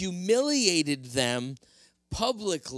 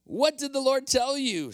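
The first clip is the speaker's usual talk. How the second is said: much higher, bright and eager; eager Saul; 10 semitones higher, 4 dB louder than usual. Acted. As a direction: lean in hoping for good news. Eager Saul asks Samuel.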